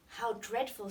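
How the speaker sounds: ordinary delivery, nothing marked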